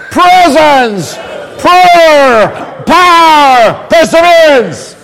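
high, loud Irish chant, word by word; rousing mock Irish. Shown as a demonstration. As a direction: shout each word for the crowd in Irish accent. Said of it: "This is the Irish getting".

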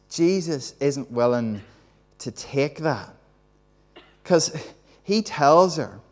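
normal talking voice

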